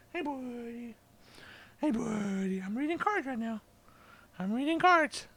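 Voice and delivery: speaking to his cat in a high soft voice; pet talk. Real, not acted. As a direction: gently coo at the cat. Talking to cat softly.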